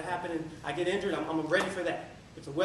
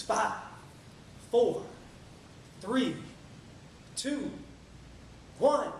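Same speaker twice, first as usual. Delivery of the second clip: start-line countdown; 5 semitones higher, much slower than usual. Acted.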